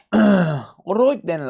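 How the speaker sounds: hammed-up Scottish drawl; mock Scottish. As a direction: play a gruff Scottish workman. Slow loud put-on voice.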